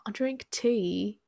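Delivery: slow, drawn-out mock British; British accent. Friend who isn't British has a go, slow British accent. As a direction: stretch it out in put-on British. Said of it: "in a British accent".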